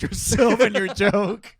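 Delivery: loud, high, breaking into laughter; amused, laughing accusation. Real, not acted. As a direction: tease through a real laugh. Laughing while teasing the other.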